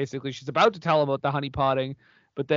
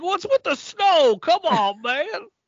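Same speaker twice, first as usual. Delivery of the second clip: annoyed director; 13 semitones higher, 4 dB louder, slower than usual. Retold or imitated.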